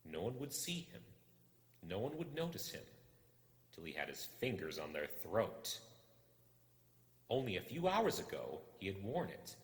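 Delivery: usual speaking voice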